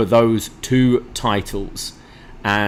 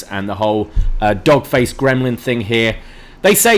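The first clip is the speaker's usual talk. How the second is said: normal talking voice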